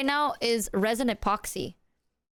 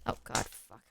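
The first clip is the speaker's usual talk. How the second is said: breathless whispered curse; panicked whisper; 13 semitones lower, 10 dB quieter than usual. Real, not acted. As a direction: hiss it in a near-drop panic. Almost drops the gift again.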